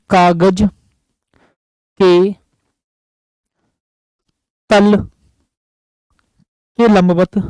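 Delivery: word by word with long pauses, as if dictating to a class; a teacher dictating a note, slow and spaced; slow dictation. Shown as a demonstration. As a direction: spell it out slowly so they can write it down. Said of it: "मैं यहाँ पर direct ये लिख देता हूँ जी".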